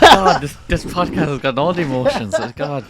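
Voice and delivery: loud, high burst of feeling; exclaiming loudly after a teary moment; emotional outburst. Real, not acted. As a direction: blurt it out, overwhelmed and half laughing.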